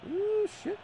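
high squeak of dismay; shocked, pitch jumps way up; dismayed yelp. Real, not acted. Missed chance in match.

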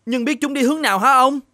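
high-pitched servant calling out to his master; a worried servant, voice up high; frantic servant. Acted. The servant calls his master about the runaway daughter, high and anxious.

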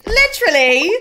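loud, high, over-the-top British; British accent. Shown as a demonstration. Streamer showing off for a friend, exaggerated British. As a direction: belt it out in an extreme British accent. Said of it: "is a British accent".

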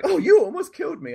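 very high, mock-outraged game passenger; caricatured woman passenger. Passenger complaining after a taxi crash, caricatured high outrage. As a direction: exclaim it in a hammed-up high voice. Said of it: "she was like".

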